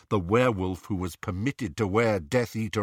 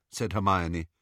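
ordinary delivery

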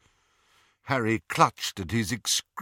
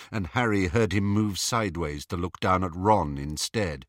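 ordinary delivery

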